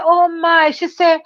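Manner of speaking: stewardess voice. Retold or imitated.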